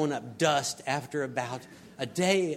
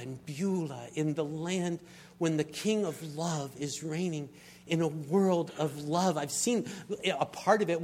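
ordinary talk, nothing marked

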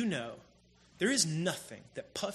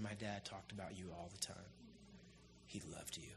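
soft retelling; 11 semitones lower, 22 dB quieter than usual. Retold or imitated. Son tells Tom of his father.

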